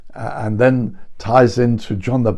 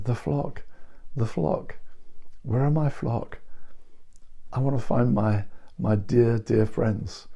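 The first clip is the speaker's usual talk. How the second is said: softer, gentle and yearning; a shepherd missing his sheep; soft, caring voice of Jesus seeking his flock; tender longing; 5 dB quieter than usual. Acted. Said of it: "No, I feel he's saying".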